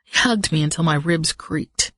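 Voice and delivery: usual speaking voice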